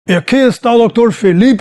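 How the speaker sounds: high, loud proclamation in Spanish; pointing him out to the crowd, loud and high; guerrilla leader calling out. Retold or imitated. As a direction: announce him to the crowd like a commander. Guerrilla leader denounces him publicly.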